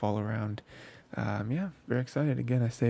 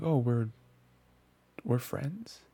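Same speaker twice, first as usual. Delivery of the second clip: offhand dismissive; 3 semitones higher, much slower than usual. Retold or imitated. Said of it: "says it in a way that could be interpreted as negative".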